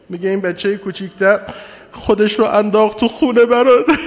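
mourning sermon lament. Acted. Preacher laments, building to grief.

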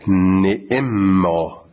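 فنعما very slow and stretched, chanted; recited reading. Shown as a demonstration. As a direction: chant فنعما with both kasras. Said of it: "نعما هر دوش با کسره". (فنعما با دو کسره، کشیده)

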